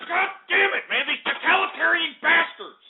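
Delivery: high-pitched frustrated screaming; furious rant. Acted. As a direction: rant like you've totally lost it. Host's rant escalating into frustrated screaming.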